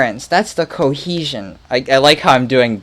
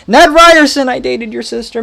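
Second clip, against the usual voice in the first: loud, high pushy salesman voice; Ned Ryerson; 12 semitones higher, 8 dB louder than usual. Retold or imitated. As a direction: blast it like an overeager old classmate. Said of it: "Ned the head".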